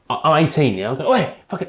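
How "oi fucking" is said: raised-pitch "oi", re-enacted; retold angry call-out. Eighteen-year-old calling after man who ignores him, angry. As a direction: hail him angrily, as retold.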